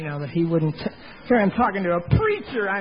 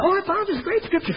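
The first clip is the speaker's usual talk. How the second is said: excited wife; 9 semitones higher than usual. Retold or imitated. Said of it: "And she said".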